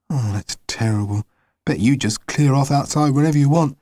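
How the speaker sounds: ordinary delivery, nothing marked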